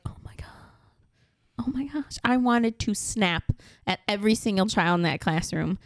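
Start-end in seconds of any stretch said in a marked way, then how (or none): 0.0-2.2 whispered under the breath, fed up; exasperated whisper. Retold or imitated. Mom listening in on class, whispering in exasperation. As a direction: hiss it to yourself, at the end of your rope. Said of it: "I was just like".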